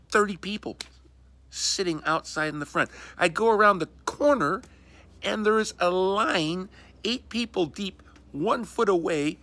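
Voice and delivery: high loud exasperated rant; outraged rant. Real, not acted. Outraged rant about crowds.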